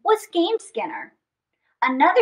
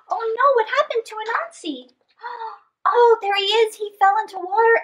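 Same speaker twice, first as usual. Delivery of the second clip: high, gasping alarm; breathless storytime alarm; gasping surprise; 8 semitones higher, faster than usual. Acted. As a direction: gasp as if the kids should worry. Teller gasps at the danger.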